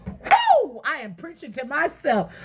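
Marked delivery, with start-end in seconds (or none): 0.1-0.8 high-pitched "woo"; excited whoop. Real, not acted. Preacher fired up by her own sermon, excited whoop. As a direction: let out a sudden high whoop.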